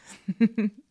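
brief teasing chuckle; playful short laugh; short giggle. Pretend. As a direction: let out a quick little laugh. Amused aside during role-play.

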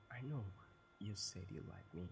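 usual speaking voice

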